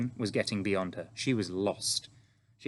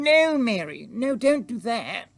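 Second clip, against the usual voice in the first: high, loud, sharp royal command; sharply forbidding, pitched high; sharp queen; 13 semitones higher, 9 dB louder than usual. Acted. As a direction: cut the maid off with a loud, high order. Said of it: "The Queen spoke so sharply".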